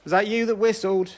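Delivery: high call into the dark; talking to a ghost. Real, not acted. Calling out to a spirit.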